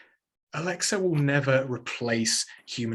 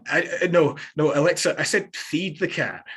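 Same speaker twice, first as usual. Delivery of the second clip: exasperated Scottish granddad, louder; correcting Alexa in a Scottish accent; broad scottish accent; 2 semitones higher, 4 dB louder than usual. Retold or imitated. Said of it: "his thick Scottish accent".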